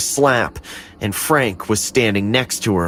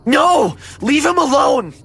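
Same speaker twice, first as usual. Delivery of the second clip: high, loud desperate cry; screaming; 3 dB louder than usual. Acted. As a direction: scream to save the doll.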